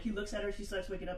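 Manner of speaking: usual speaking voice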